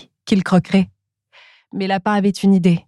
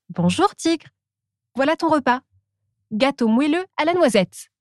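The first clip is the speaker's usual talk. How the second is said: Squirrel's voice; 6 semitones higher than usual. Acted. Squirrel brings lunch.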